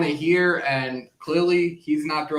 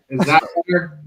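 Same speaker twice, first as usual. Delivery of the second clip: hallway echo; 4 dB louder, faster than usual. Real, not acted. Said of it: "You still sound like you're in the middle of a Mountain Mike's Pizza".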